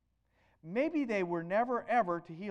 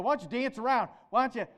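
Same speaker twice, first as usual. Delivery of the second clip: louder, jeering challenge; raised mocking dare; mocking taunt; 4 semitones higher, 6 dB louder, faster than usual. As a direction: goad them like Elijah mocking the priests. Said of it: "Elijah was taunting the Baal priests".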